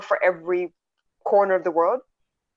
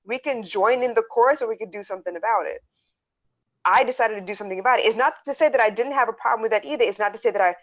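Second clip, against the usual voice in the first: normal talking voice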